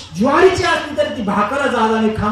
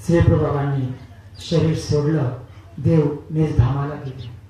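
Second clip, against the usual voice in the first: low, hushed telling with long pauses; solemn death narration; 6 semitones lower, 6 dB quieter than usual. A narrator's reading. Preacher telling devotees of the master's last day, solemn and hushed. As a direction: let each phrase sink, soft and grave.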